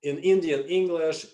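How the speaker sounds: ordinary talk, nothing marked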